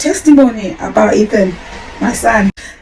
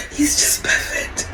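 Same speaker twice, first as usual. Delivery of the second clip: real tears breaking through, softer; crying; 10 dB quieter than usual. Real, not acted. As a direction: voice breaks into crying while praising him. Mother moved to tears by son.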